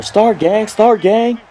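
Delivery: high calling voice; gang calling out. Retold or imitated. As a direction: call out across the park.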